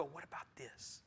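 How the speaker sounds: very soft, leaning in to ask mid-sermon; whispered question. Pretend. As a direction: murmur so the preacher can't hear. Wife leaning over during sermon.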